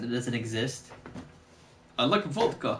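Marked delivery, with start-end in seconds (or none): 2.0-2.8 badly faked Russian accent; terrible Russian accent. Shown as a demonstration. Showing how their Russian accent fails, bad Russian accent. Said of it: "the Russian accent just turns into a terrible accent".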